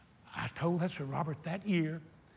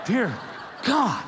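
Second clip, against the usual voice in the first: higher, louder cry of dismay; a comic panicked prayer; mock alarm; 7 semitones higher, 7 dB louder than usual. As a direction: exclaim it half laughing. Retold exclamation with laugh.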